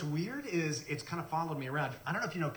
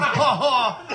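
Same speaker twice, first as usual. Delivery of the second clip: loud, higher fake ha ha; mock laugh; 9 semitones higher, 14 dB louder than usual. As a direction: laugh at him mockingly. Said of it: "And he goes".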